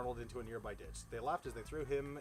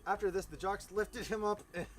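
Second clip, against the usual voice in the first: ordinary delivery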